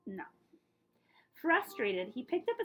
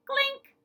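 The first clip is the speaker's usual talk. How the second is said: sharp high clink; a high bright clink; mock hammer clink; 9 dB louder than usual. A narrator's reading. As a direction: ring it out like metal striking rock. Sound effect word in story.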